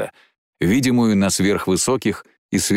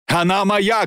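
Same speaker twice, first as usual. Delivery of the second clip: high, impassioned cry; fierce high-pitched shout; shouted claim; 10 semitones higher than usual. Acted. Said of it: "крикнул он".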